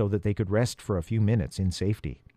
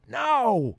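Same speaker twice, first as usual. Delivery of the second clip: high crow shout; 12 semitones higher than usual. Acted. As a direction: yell the signal. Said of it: "he heard Jackson shout".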